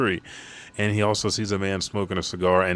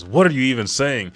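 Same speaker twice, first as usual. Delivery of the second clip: louder, higher insistent yell; 4 semitones higher, 4 dB louder than usual. Retold or imitated. Brook yells while towering over Shuri. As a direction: shout her words back at her. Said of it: "Brook yelling".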